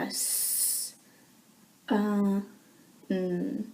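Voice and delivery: sun sounded out slowly as s, uh, mm; sounding out words. Shown as a demonstration. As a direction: stretch each sound of sun apart. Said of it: "You would sound it out slowly".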